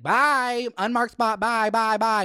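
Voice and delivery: high sing-song mocking bye; taunting goodbye. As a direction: wave her off sweetly. Taunting neighbor while walking away.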